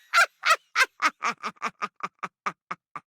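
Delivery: long staccato villain laugh; villain's cackle. Acted. Villain ends the confession laughing.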